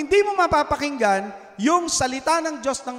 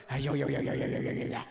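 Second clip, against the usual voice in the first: soft, breathy babble mimicking gossip; mock whispered chitchat in the pew; mock chatter; 9 semitones lower, 8 dB quieter, faster than usual. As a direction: imitate a neighbour yakking through the sermon. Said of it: "dadaldalin mo pala yung katabi".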